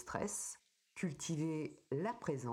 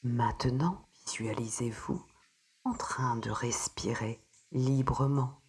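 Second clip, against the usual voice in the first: soothing spa whisper; 3 semitones lower, 8 dB louder than usual. Acted. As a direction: ease the listener gently into calm. Guides a breathing meditation.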